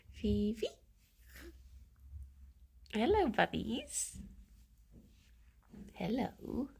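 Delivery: normal talking voice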